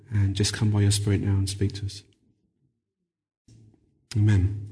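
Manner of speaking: hushed opening prayer. Real, not acted. Opening prayer before sermon.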